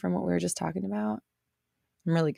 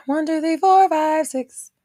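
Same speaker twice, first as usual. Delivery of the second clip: put-on high, louder sing-song counting like a kids' TV puppet; Sesame Street counting; 9 semitones higher, 7 dB louder than usual. Streamer counting a viewer's numbers in Sesame Street voice. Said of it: "I had to say it like, um, Sesame Street".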